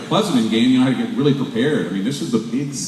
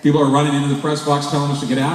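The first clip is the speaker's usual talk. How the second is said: ordinary talk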